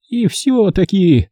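put-on high, slow old woman's remark; Saeunn's voice. A narrator's reading. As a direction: drawl it doubtfully. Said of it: "послышался голос Саюн".

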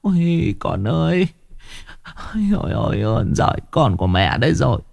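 high, whimpering, half-sobbing relief; mother hugging her rescued son, crying and pleading; tearful mother. A narrator's reading. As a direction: blubber over your son who's back. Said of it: "mếu máo".

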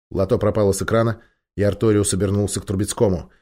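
plain reading voice

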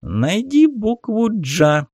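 high slow squeaky toy voice, teasing; cartoon voice. A narrator's reading. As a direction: prod like a cheerful kids' toy. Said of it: "подначал мультяшный голос". (мультяшный дразнящий голос)

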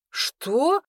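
high, rising astonished question; surprised. A narrator's reading. Kirush hears unexpected denial from his cousin, surprised. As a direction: let it leap up in disbelief. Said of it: "Кируш удивлённо уставился на меня".

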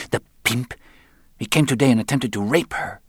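sister's heated report; outraged. A narrator's reading. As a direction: denounce him with disgust. Marta denounces Roland to her brother.

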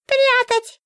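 very high, firm book voice; firm talking book. A narrator's reading. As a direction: declare it firmly in the book's squeaky voice. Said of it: "твёрдо заявила книга". (твёрдо, очень высоким голосом книги)

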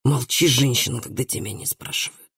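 stern order sinking low and soft at the end. A narrator's reading. Father hushes his daughter, low and stern. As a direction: silence her sternly, dropping the voice. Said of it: "сказал ей отец строгим тоном".

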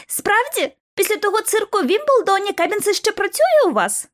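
high-pitched, teasing needling; giggly taunter. A narrator's reading. Bobby Vernon taunts the rival team, giggly jibe. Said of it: "Бобі Вернон захихотів".

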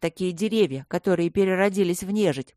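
ordinary delivery, nothing marked

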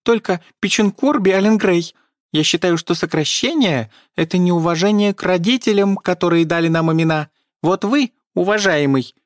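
high, indignant elf lecture; indignant squeaky elf. A narrator's reading. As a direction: lecture them in a squeaky huff.

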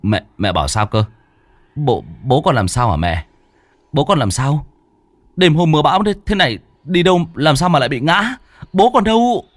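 rising, urgent questioning; a son anxiously pressing his mother; hurried questions. Acted. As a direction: press your mother for answers. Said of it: "gấp gáp hỏi lại".

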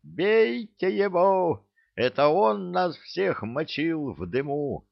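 high-pitched accusing yell; shouting. A narrator's reading. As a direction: rouse the mob with a drawn-out cry. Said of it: "закричал".

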